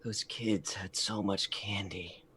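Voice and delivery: quiet slow mutter to himself. Acted. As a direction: mutter it under your breath. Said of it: "he's muttering to himself".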